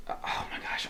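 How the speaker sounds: breathy whisper to herself; whispered aside. Real, not acted. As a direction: mutter it under your breath, embarrassed. Flustered at forgetting lore.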